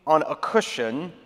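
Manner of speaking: dramatic scripture reading. A narrator's reading. Pastor reads the storm story to the congregation, dramatic scripture reading. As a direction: read scripture with long pauses and weight.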